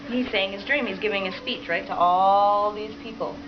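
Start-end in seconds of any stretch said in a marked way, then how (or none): none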